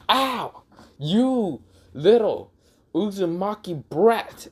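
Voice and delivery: high, slow, drawn-out scolding; pained outrage. Acted. Hinata hurt by Naruto's feet, outraged. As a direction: stretch each word in indignant pain.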